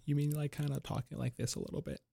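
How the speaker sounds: very soft, pinched held-breath voice; inhaled cigarette voice. Shown as a demonstration. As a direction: talk with the smoke still held. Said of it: "I have to get into character".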